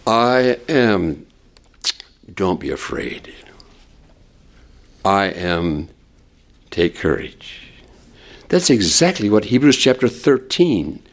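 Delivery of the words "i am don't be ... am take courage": hushed, reassuring repetition; tender reassurance. Acted. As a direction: speak the comfort gently to the hearers. Pastor applies the comfort.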